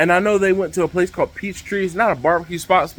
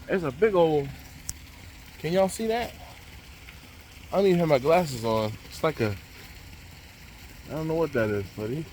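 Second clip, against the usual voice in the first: hushed; 9 dB quieter than usual. Real, not acted. Spotting an animal nearby.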